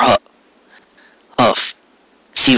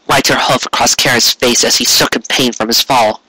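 ordinary delivery

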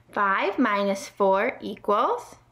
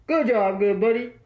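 CB radio trucker talk; truck driver radio voice. Pretend. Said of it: "the truck driver cheer".

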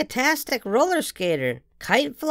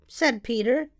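ordinary delivery, nothing marked

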